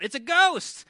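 high, loud panicked cry, hammed up; mock fearful scream. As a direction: act out a freak-out, crying out loudly. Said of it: "They're crying out, screaming in fear".

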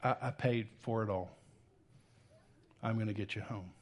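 softly comforting; tender reassurance. Pretend. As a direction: speak it like a gentle promise. God's comforting words to speaker.

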